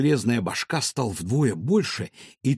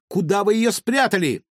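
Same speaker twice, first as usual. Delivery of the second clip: shouting; 7 semitones higher, 4 dB louder than usual. Acted. Girl demands grandmother back.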